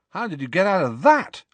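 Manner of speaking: high, loud, amazed youngster; astonished question. Acted. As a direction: blurt it out in disbelief. Harry reacts to the danger.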